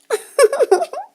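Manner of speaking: high, tittering giggle; soft mouse laughter. Acted. Mice hidden under the piano lid, soft giggle. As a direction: giggle softly from hiding. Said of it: "soft laughter".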